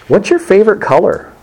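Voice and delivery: high, earnest date-night question; husband courting wife on date night; mock sweet husband.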